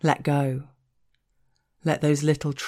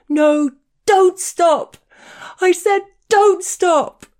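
slow, very high, loud shriek of confusion; angry, pained shriek; 10 dB louder, slower than usual. Acted. Man spluttering back at the narrator, angry pained shriek. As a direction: shriek like a wounded animal. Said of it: "shrieked in a voice that resonated with anger and confusion".

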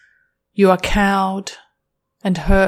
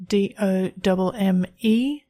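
letter by letter, slow and clear; plain outro spelling the site name, slow; spelled out slowly. Shown as a demonstration. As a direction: spell it out so they can type it. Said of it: "spelled out".

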